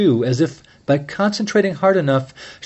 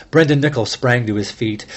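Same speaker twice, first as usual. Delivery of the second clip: usual speaking voice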